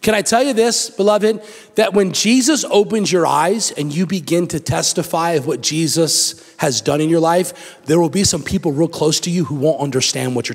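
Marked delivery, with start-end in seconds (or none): none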